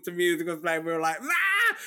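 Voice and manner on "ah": high short mock shriek of joy; retold scream. Crowd in reopened bar cheering songs, high mock shriek. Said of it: "literally screaming at songs".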